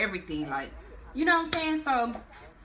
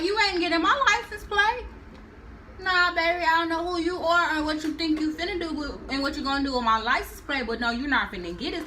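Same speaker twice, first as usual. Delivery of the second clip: higher, louder retold refusal; firm refusal; 8 semitones higher, 3 dB louder than usual. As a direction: stand your ground, louder and higher. Mama Michelle refusing the guard.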